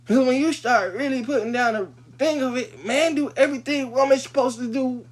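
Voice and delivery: loud, high-pitched argument; indignant challenge. Acted. Heated rhetorical challenge to women.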